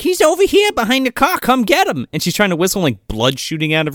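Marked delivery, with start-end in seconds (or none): 0.0-2.1 mock call to the werewolves. Girl calling to werewolves.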